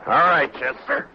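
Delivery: calling out. Acted. Marshal calling Chester as they set out, calling out. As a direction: call out briskly to a companion. Marshal calls to his deputy.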